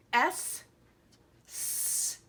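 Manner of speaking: S hissed, second one whispered; s sound. Shown as a demonstration. Teacher shows the sound xigua starts with, hissed s. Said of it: "sounds like it".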